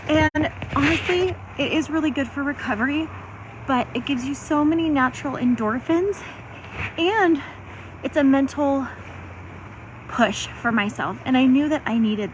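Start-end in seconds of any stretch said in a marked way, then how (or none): none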